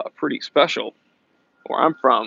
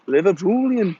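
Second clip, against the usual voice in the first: mock Scouse, higher than usual; playful Liverpudlian imitation; Liverpool accent; 4 semitones higher than usual. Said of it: "impression of a Liverpool accent".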